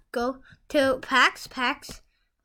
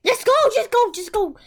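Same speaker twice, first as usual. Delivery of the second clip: urgent; 9 semitones higher, 8 dB louder than usual. Real, not acted. Urging friend to pick.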